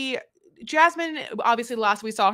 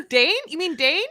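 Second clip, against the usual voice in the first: mock confused question; 6 semitones higher, 3 dB louder than usual. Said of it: "I was like".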